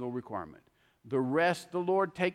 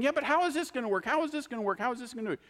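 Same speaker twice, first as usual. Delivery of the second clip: much higher, fast, worried asking; anxious fretting; 9 semitones higher, faster than usual. Retold or imitated. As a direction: fret over how it will ever work. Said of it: "and we keep going".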